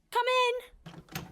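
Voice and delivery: high, loud call through the door; bright loud call to the visitor. Acted. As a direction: shout to be heard outside. Calling to someone at door.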